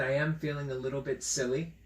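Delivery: ordinary delivery, nothing marked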